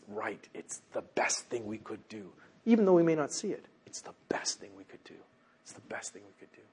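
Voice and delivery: low, very soft, whispery repeat; whispered reassurance. Real, not acted. Preacher assures congregation it is for their good, whispered reassurance. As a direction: let it sink to a confiding whisper.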